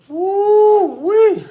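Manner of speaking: loud, high-pitched woo-wee, drawn out and falling. Real, not acted. Streamer whoops at twenty-five push-ups, loud and high. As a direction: whoop it way up high and let it fall.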